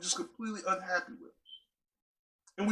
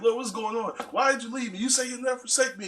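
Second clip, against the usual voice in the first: frustrated prayer; 6 semitones higher than usual. Retold or imitated. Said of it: "real frustrated".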